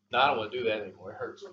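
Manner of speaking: fast, low, soft complaint; whiny quitting. Retold or imitated. Tired exerciser quitting squats, fast soft whine.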